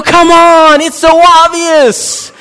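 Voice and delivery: high loud slow come on; pleading at the obvious; high loud mock exasperation; exasperated. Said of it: "You go".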